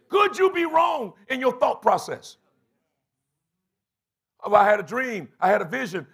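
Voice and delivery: high, loud, shouting the questions at the church; full-force preaching. Real, not acted. Pastor challenging his congregation, preaching loud and high. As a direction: drive each line home like a call.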